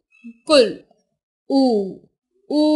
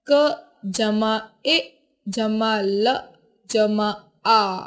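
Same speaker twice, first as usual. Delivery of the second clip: slow sound-by-sound spelling; 4 semitones lower, 3 dB quieter, much slower than usual. Shown as a demonstration. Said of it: "ध्वनियां निकलती हैं".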